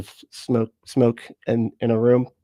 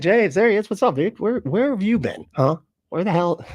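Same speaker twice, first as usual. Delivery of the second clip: high-pitched playful hailing; excited greeting; 7 semitones higher than usual. Pretend. Host welcoming James back to chat, playfully excited greeting.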